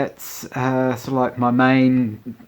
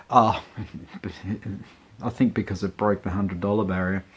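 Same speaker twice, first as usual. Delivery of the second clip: ordinary talk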